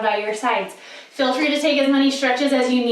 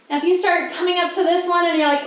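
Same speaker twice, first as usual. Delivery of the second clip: normal talking voice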